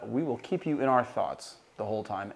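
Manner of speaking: usual speaking voice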